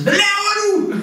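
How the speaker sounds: high-pitched outburst, far above normal speech. Acted. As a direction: yell it at someone standing right beside you. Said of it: "det med at hæve stemmen".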